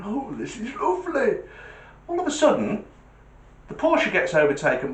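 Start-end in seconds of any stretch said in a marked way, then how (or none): 0.0-1.4 put-on contented old man. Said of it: "and he's thinking".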